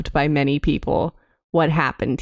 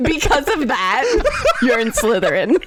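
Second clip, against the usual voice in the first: laughing; 5 semitones higher than usual. Real, not acted. Laughing verdict on friend.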